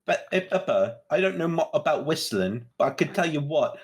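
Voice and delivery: British cartoon-character riff; mock British. Host topping the whistling bit, mock British. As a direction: put on a British accent for a cartoon parody.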